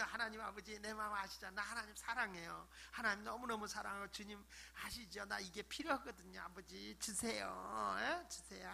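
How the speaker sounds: soft, intimate coaxing prayer; endearing prayer. Acted. Telling Father God of love and need, soft coaxing. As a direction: murmur lovingly to a father, gently asking. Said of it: "하나님 마음에 들게끔 기도하란 말이에요".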